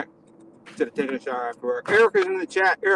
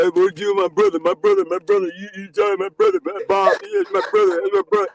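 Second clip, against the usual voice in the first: a lightly voiced excited younger brother, sometimes jumping high; mock the kid brother gushing about Bob; imitating eighty-four; 4 semitones higher, 4 dB louder than usual. Host mimicking eighty-four bragging about his brother, lightly hammed-up. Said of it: "I don't wanna hear eighty-four talking about".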